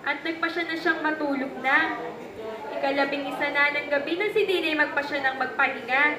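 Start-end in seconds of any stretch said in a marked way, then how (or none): none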